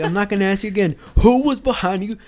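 loud last-warning interrogation; imitating a drill instructor demanding. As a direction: bark the question like a fed-up sergeant.

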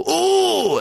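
high, drawn-out mock "ooh"; startled cry. Tourist hit by a jet of water, mock startled cry. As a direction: yelp as if hit in the back. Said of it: "he would just go".

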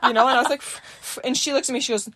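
ordinary delivery

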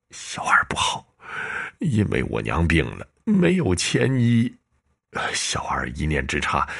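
crying whisper, voice breaking; tearful confession. Acted. Dying servant confessing the theft to his master, tearful. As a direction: sob it out in shame. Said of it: "陈小二眼角渗出泪水".

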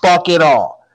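loud, furious rant, slow at first; angry rant. Real, not acted. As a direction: let it all out at the team. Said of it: "I've been given the green light".

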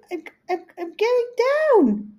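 stammer, then a high drawn-out mock wail; very high, slow comic cry; imitating mother going down. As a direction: catch on the first word, then wail it slowly up high. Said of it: "and she's, I hear".

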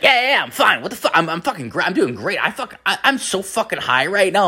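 high-pitched, jittery caffeine-fueled babble; mock over-caffeinated voice, pitched right up; imitating wired friend. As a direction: play a friend buzzing way too hard.

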